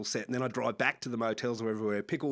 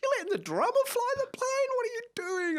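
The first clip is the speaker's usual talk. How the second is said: much higher, disbelieving squawk; incredulous mock outrage. Bandmate aghast that the drummer flies the plane, high incredulous squeal. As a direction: play up the disbelief at a crazy choice.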